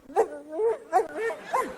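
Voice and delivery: high-pitched dog woofs; short, high barking woos; Lassie. Acted. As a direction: imitate an alert dog's yelps. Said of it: "Lassie who would go".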